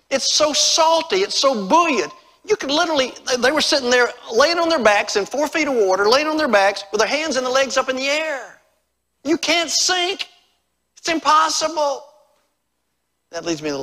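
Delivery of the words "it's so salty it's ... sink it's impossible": high, loud, marvelling preacher; excited preaching. Real, not acted. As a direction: raise the voice in amazement, pressing each phrase. Preaches excitedly about floating.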